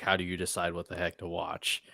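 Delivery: usual speaking voice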